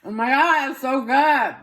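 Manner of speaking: high, loud burst of delight; delighted exclamation. Real, not acted. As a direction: exclaim as if bowled over by the taste. Delighted on tasting the food.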